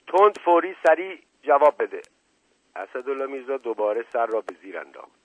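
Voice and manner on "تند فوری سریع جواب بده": high, loud interrogator's order; urgent command. A narrator's reading. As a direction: demand an answer on the spot. Inspector demands an instant answer. (دستور بلند و آمرانه)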